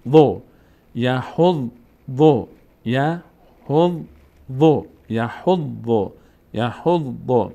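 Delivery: "یحظ، ظو" with a doubled w, slowly; syllable-by-syllable. Shown as a demonstration. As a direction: hold the w ending "یحظ", then "ظو".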